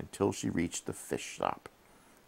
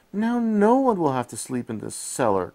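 much higher, louder joyful cry; bursting with relief; happy cry; 9 semitones higher, 7 dB louder than usual. A narrator's reading. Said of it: "cried Jenny happily".

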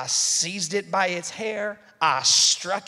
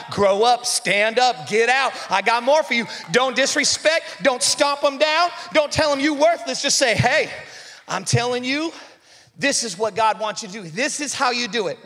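loud, high, driving sermon delivery; full-force preaching; 4 semitones higher, 6 dB louder than usual. Acted. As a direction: preach it hard, building the crowd up.

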